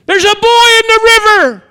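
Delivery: high, loud alarmed shout from the riverbank; a villager yelling that a child is in the water; alarmed cry. Retold or imitated.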